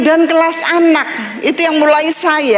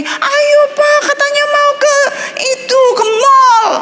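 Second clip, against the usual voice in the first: put-on high, excited kid voice pestering dad; eager child; 11 semitones higher than usual. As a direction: tug at dad's sleeve with your voice. Child nagging busy father.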